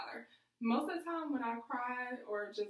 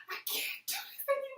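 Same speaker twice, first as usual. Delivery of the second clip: much higher, strained imitation of screaming; retold tearful scream; 3 dB louder, faster than usual. Crying to dad on the phone, high mock scream. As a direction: re-enact a high wailing breakdown. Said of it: "to just, like, screaming".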